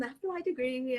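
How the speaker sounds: ordinary talk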